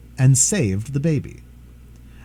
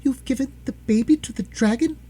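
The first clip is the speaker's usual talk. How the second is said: high, outraged cry of a wife; shocked wife; 12 semitones higher than usual. A narrator's reading. Wife cries out at John in high horror. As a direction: gasp it out in horror. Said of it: "she cried".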